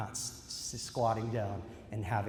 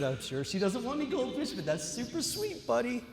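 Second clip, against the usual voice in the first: put-on higher sing-song voice to a little boy; mom to toddler; 10 semitones higher than usual. Mother telling her toddler son not to share, higher and sing-song. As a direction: soothe the child while apologizing.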